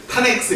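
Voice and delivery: "탄 X", tan read as one short word; misread "tan". Shown as a demonstration. Teacher showing a misreading, 탄 for tangent. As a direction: say 탄 X instead of the full word tangent. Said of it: "이렇게 읽으면 안 되고".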